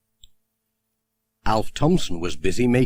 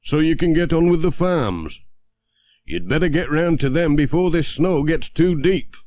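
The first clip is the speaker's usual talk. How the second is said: higher, louder friendly villager; Ted's voice; 6 semitones higher, 3 dB louder than usual. Acted. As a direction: urge him on cheerfully, pitched up. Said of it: "said Ted".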